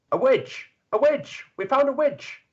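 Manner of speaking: high, loud villager yells; angry mob shouts. Acted. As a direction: cry out like a mob that's found a witch. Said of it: "you hear shouts of".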